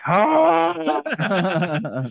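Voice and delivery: high scream. Retold or imitated. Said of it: "Victoria's like".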